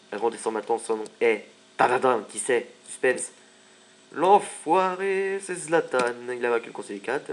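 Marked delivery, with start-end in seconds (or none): 4.1-6.1 high, very slow and drawn-out announcement, louder; dramatic reveal. Acted. Gamer revealing the rival to viewers, hammed-up and dramatic. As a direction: stretch it out like a big suspense reveal.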